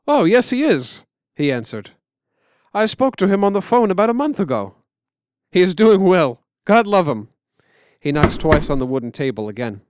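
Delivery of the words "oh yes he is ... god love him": eager answer. Real, not acted. Old man happily answering.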